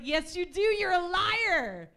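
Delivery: accusing a friend loudly; accusing rant. Retold or imitated. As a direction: call him a liar to his face. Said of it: "I was like".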